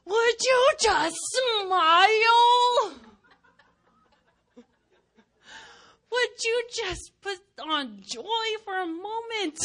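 high, loud mock yell; exasperated outburst. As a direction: burst out in comic exasperation. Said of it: "everything inside of me wants to go".

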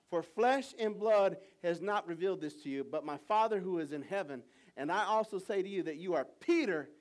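loud, high proclaiming read; preached scripture. Acted. Pastor reading Peter's answer aloud, loud proclaiming. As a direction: proclaim it to the room.

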